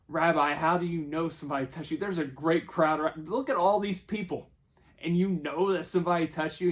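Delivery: acted-out exasperated disciples; incredulous protest. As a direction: protest loudly over a crowd.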